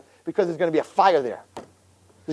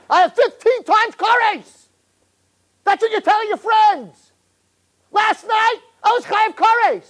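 mock shouted boast; 12 semitones higher, slower than usual. Real, not acted. Rabbi throws the youths' boast back at them in a high shout.

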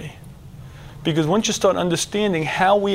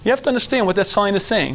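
usual speaking voice

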